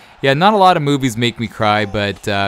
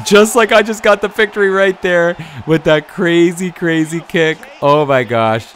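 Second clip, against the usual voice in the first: much higher and louder, cheering a last-second victory; excited win cry; 8 semitones higher, 7 dB louder than usual. Real, not acted. Cheering his own last-second game win, excited shout. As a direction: yell it out like you just won the fight.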